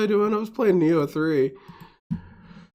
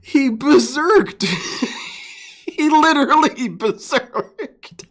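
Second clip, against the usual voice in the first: slow, much higher, laughing disbelief; amazed laughing; 9 semitones higher, 3 dB louder, slower than usual. Real, not acted. Streamer marveling at Phil's berserk roar, laughing. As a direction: stretch it out in delighted shock. Reacting to Phil roaring.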